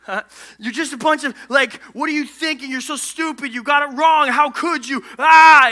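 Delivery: hammed-up angry scolding, building to a yell; mock furious God. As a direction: rant in imitation of a furious God, ending in a shout. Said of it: "he's angry and he's furious".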